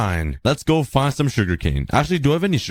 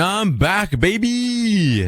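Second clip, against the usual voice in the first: excited; 11 semitones higher, much slower than usual. Real, not acted. Triumphant return from long trek.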